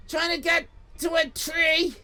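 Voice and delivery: ordinary delivery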